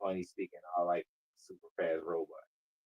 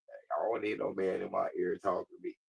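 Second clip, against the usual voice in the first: ordinary talk, nothing marked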